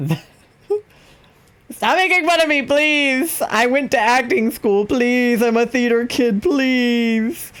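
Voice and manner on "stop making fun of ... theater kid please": high, hammed-up pleading theater kid; whiny mock protest. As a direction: beg them to stop in a put-on squeaky voice. Protests teasing about her voice.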